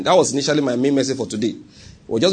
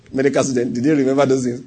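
ordinary talk

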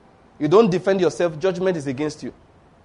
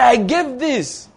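drawn-out, slow proud claim; slow boastful believer; 6 semitones higher, much slower than usual. Acted. A believer boasting of his giving to God, slow and smug. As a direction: stretch it out like a smug giver.

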